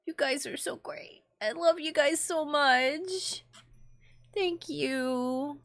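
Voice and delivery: soft, whispered thanks, slowed and tender; moved almost to tears, speak softly; touched and emotional. Real, not acted. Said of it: "I'm getting emotional".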